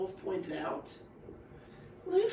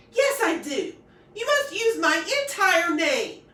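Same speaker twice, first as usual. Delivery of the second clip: haughty insistence; 8 semitones higher, 10 dB louder than usual. Acted. Girl insists on full name.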